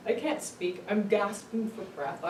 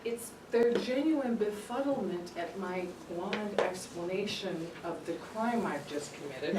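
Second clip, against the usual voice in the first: ordinary talk, nothing marked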